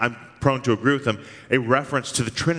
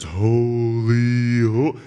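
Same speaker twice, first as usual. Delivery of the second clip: half-hearted holy; 4 dB louder, much slower than usual. Retold or imitated. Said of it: "heard some Christians".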